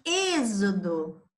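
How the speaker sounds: "êxodo" slowly, X voiced as Z; teacher sounding out "êxodo" for pupils to hear; pronunciation for listening. Shown as a demonstration. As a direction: stretch the first syllable of "êxodo" into a clear Z. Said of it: "Som de Z".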